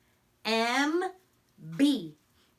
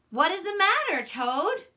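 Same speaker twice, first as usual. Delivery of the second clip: voicing Frog; 7 semitones higher than usual. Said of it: "Frog came along and said".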